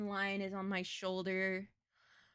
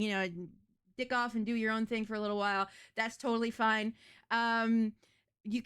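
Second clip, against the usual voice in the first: ordinary talk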